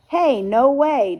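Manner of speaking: loud, high, drawn-out objection; protesting. A narrator's reading. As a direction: object loudly, stretching it out. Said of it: "began to protest".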